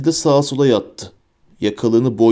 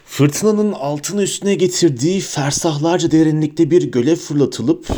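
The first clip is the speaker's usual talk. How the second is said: ordinary delivery, nothing marked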